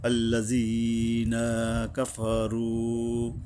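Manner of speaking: কাফারু with the ফা held one alif long; elongated fa. Shown as a demonstration. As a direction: draw out ফা in কাফারু slowly. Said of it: "এই ওয়ার উপরে এক আলিফ টানতে হবে".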